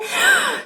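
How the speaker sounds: sharp high offended gasp; mock gasp. The ego voice reacting to a no, a high theatrical gasp. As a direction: gasp like an insulted princess. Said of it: "another voice that tries to hijack you".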